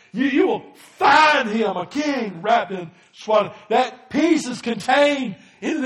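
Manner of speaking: loud, slowed proclaiming; preaching shout. Real, not acted. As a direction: call it out to the room. Preacher exhorting the congregation.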